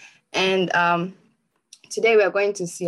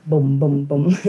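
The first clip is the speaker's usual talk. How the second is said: low, louder booms like a pounding heart; heartbeat imitation; 3 semitones lower, 5 dB louder than usual. Showing the audience a racing heartbeat, low loud booms. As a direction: thump out each boom.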